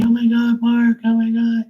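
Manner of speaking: very high and loud, delighted shriek; gushing high-pitched OMG; squealing excited greeting. Retold or imitated. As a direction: imitate two girls squealing hello. Sisters greet him at club.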